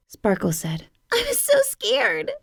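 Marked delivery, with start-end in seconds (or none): 1.1-2.3 much higher, little lost-kitten voice; small kitten voice. A narrator's reading. Lost kitten telling her mom, much higher and small. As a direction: sound small and relieved.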